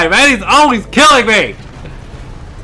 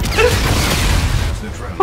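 shrill startled laugh; 11 semitones higher, 7 dB quieter than usual. Real, not acted. Laughing after a fright.

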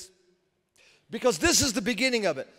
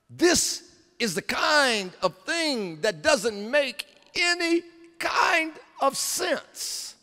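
slow, climbing to a high loud shout; drawn-out preacher's rising cry; preaching at full force; 10 semitones higher, 4 dB louder, slower than usual. Acted. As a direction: build the sermon to its peak. Preaching builds to climax.

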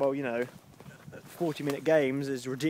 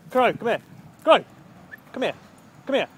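short, raised calls; calling the dog; 7 semitones higher, 7 dB louder than usual. Real, not acted. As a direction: summon the dog firmly. Calling the dog away from car.

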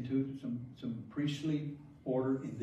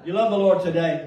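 much louder, higher rally cry; big joyful call to the crowd; 5 semitones higher, 12 dB louder than usual. Real, not acted. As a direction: rouse the congregation. Calling out to congregation.

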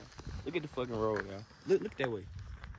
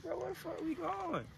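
high mock girlfriend whining, much higher; girl voice; 5 dB quieter than usual. Vlogger mimics his date on the dirt road, high mock whine. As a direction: imitate a confused girl being driven off. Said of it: "She gonna be like".

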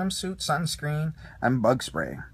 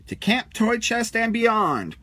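much higher, slow heroic proclamation; Buzz cries out; 8 semitones higher, 4 dB louder, slower than usual. A narrator's reading. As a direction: proclaim it like a space hero. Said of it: "cried Buzz".

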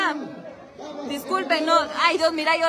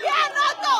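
cheering; 11 semitones higher than usual. Real, not acted. Cheering a player.

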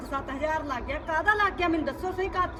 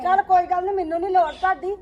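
louder, sharp angry retort; angry outburst; 6 dB louder than usual. Acted. Aunt shouting at her sister-in-law in the fight. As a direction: throw it back at them hard.

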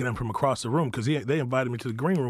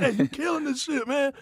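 much higher, hyped imagined compliment; imagined praise; 14 semitones higher than usual. Retold or imitated. Guest voicing the compliment he expected from Kevin. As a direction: play the praise you hoped to hear. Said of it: "I thought he was gonna be like".

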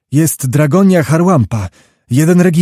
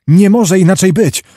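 raised-pitch, urgent assertion; feverish insistence; 5 semitones higher, 3 dB louder than usual. A narrator's reading. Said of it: "mówił gorączkowo Wołodyjowski". (gorączkowe, podniesione zapewnienie)

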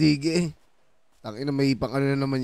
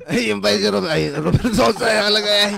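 laughing; 6 semitones higher, 6 dB louder than usual. Real, not acted. Amused by the character Romer.

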